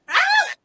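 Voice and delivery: high delighted shriek; excited scream. Real, not acted. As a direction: squeal with excitement. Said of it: "the scream I scrumped".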